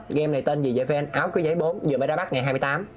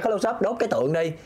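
usual speaking voice

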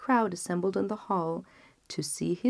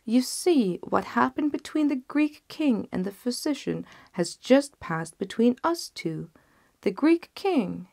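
lightly raised, pointed telling; fisherman; 6 semitones higher, 3 dB louder than usual. Acted. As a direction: drive the moral home to the genie. Fisherman lectures the genius.

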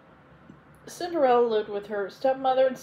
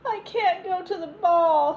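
high, slow, tearful plea; a hint of sobbing, drawn out and higher; 9 semitones higher, 4 dB louder than usual. A narrator's reading. Cinderella tells her godmother she can't go, tearful. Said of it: "sobbed Cinderella".

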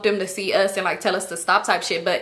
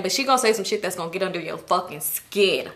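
usual speaking voice

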